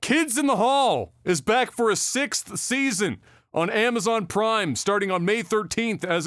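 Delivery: high, slow, emphatic announcer delivery. Acted. Host announcing a comedy show's return, high emphatic announcer voice. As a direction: announce it big, stretching out the title.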